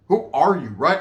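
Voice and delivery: ordinary talk, nothing marked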